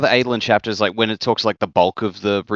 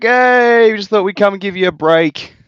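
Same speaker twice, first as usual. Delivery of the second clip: cheery mock greeting; 13 semitones higher, 6 dB louder than usual.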